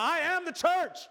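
high, hammed-up fervent shout; bout-it declaration. Preacher imitating a fired-up church, hammed-up bold declaration. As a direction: belt it out like a fired-up believer. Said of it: "Some of you have, like, a bout it, bout it church".

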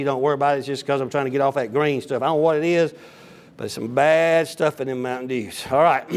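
ordinary talk, nothing marked

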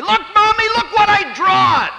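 put-on a small child proudly calling to mommy; excited little kid. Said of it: "throw themselves in the arms of my wife and say".

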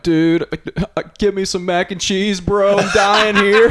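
whiny begging friend. Retold or imitated. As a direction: beg like you're dying for mac and cheese. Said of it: "text messages going like".

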